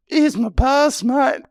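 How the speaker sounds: squeaky high proud announcement; giddy kid voice flashing a pass; mock high voice. High-on-weed bravado to door guy.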